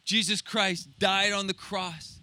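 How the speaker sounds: ordinary talk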